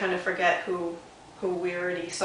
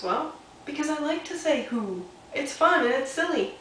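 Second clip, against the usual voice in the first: higher, cheerful little rabbit; a hint of a happy bunny; 7 semitones higher than usual. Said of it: "And Little Rabbit says".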